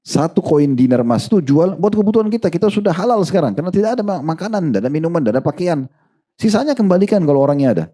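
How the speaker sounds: voice of the pleading wife. Retold or imitated. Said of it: "istrinya bilang".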